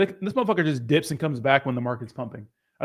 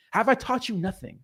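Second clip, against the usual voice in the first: higher-pitched mock reproach; put-on disappointed teacher; mock scolding; 6 semitones higher, 3 dB quieter than usual. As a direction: playfully chide a pupil. Mock scolding a chatter named Jay.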